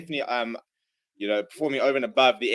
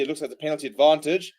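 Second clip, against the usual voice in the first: ordinary delivery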